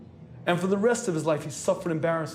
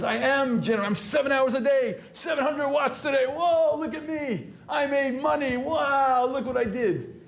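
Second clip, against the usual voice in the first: smug boasting; 9 semitones higher than usual. Retold or imitated. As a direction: crow about it like a show-off. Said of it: "he says".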